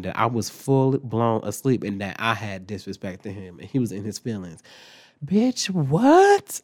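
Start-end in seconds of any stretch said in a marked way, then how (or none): 5.2-6.6 loud, high-pitched disbelief; incredulous outburst. Real, not acted. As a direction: shoot the question way up in outraged disbelief. Reacting to coworker's false report.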